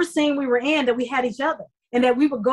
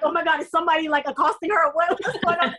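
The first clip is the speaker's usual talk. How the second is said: alarmed bystanders; 6 semitones higher, faster than usual. Retold or imitated. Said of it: "I'm sure people were like".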